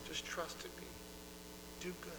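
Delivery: soft, hushed reassurance; calming Lord. Acted. God to the frustrated believer, soft calming. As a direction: drop soft and settle them. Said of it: "calming their spirits".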